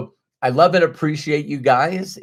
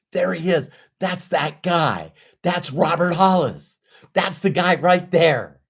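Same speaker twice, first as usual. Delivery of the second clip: a stranger spotting a celebrity; excited recognition; 6 semitones higher than usual. Retold or imitated. Mentor imagines strangers recognizing Robert, lightly excited. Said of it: "You're gonna hear".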